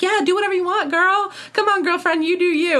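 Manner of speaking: high, mock-encouraging girlfriend voice; imitated high-pitched cheerleading of a friend; egging on. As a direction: hype her up in a put-on peppy voice. Acting out Jessica egging Olivia on.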